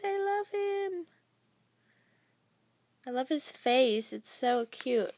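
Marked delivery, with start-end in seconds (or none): 0.0-1.1 high, slow, cooing voice; cutesy gush. Real, not acted. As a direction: coo over something adorable. Gushing over a cute charm.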